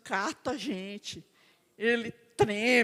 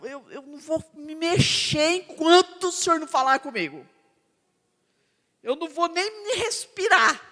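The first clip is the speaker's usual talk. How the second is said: louder, higher pleading prayer to the Lord; 4 semitones higher, 7 dB louder than usual. Pretend. Believer praying hard, refusing to move until God speaks. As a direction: beg God to speak, voice straining. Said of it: "você falou".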